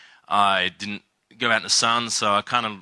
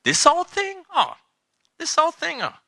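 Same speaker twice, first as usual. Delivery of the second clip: much higher, coy and delighted; flattered girl. Retold or imitated. Girl answering his compliment on her dress, coy, much higher. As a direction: play the girl brushing off the compliment, pleased. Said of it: "and she's like".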